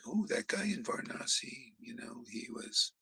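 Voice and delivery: very soft, low, mock-calm retelling. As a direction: show how flat the wrong version sounds. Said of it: "And he very calmly says it".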